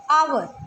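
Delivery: "आवर" said as "our", with no h; "आवर" without the h sound; silent h. Shown as a demonstration. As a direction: start "आवर" on the open vowel. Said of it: "हावर नहीं है ये".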